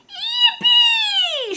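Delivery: very high, drawn-out joyful squeal; little girl shouting. A narrator's reading. Little girl jumps on her new bed, high excited shout. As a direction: whoop it like a delighted child. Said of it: "shouted the little girl".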